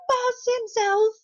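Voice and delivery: high, slow, drawn-out emphasis; grand reveal. Retold or imitated. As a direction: savor the big reveal slowly. Mock grand introduction of Jack.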